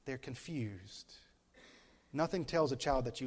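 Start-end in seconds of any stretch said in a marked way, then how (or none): 0.1-1.1 soft. Acted. Soft slow aside.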